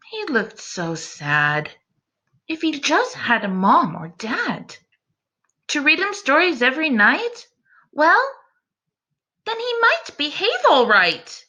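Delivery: rhyming read-aloud, rising toward the end; storybook reading. A narrator's reading. Teacher reading a picture book to her class, storytelling voice. Narrating Eliza's thoughts.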